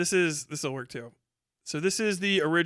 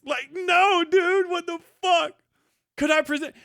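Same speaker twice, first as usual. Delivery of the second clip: much higher, loud outraged yell; incredulous shout; 11 semitones higher, 4 dB louder than usual. Real, not acted. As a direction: shout in real disbelief. Outraged at the excuses.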